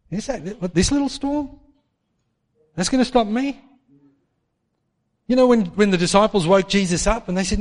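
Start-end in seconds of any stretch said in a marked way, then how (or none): 0.1-3.6 high, scoffing disbelief. Retold or imitated. Believer scoffing at the storm, high and dismissive.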